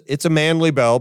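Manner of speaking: usual speaking voice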